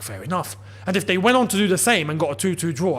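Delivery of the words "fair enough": low, quiet, shrugging acceptance; resigned fair enough. Retold or imitated. Fan shrugging off a proper Arsenal goal, low and resigned. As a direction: concede it with a sigh. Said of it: "I'd be like".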